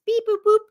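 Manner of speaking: high, loud robot beeps; puppet beeps. Pretend. Host playfully voicing a puppet for viewers, robot beeps. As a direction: chirp it like a little toy robot.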